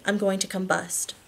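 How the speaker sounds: usual speaking voice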